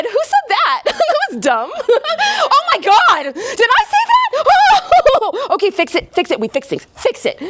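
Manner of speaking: high, laughing panic; a mortified brain scrambling; panicked inner voice. Acted.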